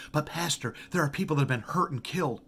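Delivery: pleading objection from the pews; imagined objector. Retold or imitated. Voicing an imagined objecting listener.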